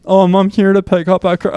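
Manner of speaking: a light hint of a friend's voice; Heck voice. Picking up food in a friend's voice. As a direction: mildly mimic a buddy's dopey way of talking. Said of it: "I would do the fucking Heck voice".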